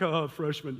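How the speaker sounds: low, dismissive jeer; passing runners' mockery. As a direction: toss it off in a low teasing voice. Passing runners mocking him.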